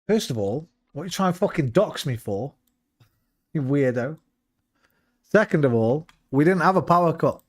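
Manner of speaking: normal talking voice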